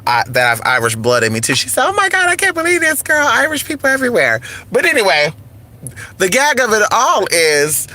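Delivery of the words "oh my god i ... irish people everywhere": high, quick exclamation of disbelief; imitating a stunned friend, pitched way up; shocked disbelief. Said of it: "she was shocked when she found out".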